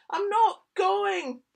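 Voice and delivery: high, loud, slow protest; cried out. Acted. Sam cries out refusing bed. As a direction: cry it out defiantly. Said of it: "cried Sam".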